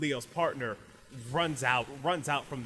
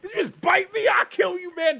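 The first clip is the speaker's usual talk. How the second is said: high, loud furious threat; outraged shout; 12 dB louder than usual. Acted. Fighter threatening the other student, outraged shouting. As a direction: scream it mid-brawl.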